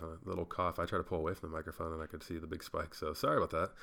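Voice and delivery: ordinary talk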